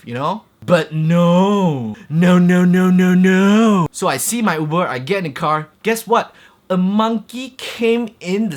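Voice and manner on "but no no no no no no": louder no, no, no building up; loud repeated no. Real, not acted. Vlogger denying hope to his viewers, loud. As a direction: hammer each no harder.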